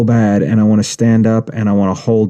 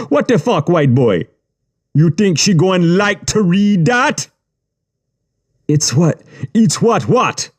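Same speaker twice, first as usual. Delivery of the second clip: much higher, heated Caribbean-tinged voice; angry gang boss; 9 semitones higher than usual. A narrator's reading. Yubi berating the boy for his writing, high and heated. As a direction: tear into him for the words.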